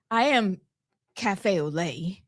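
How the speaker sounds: normal talking voice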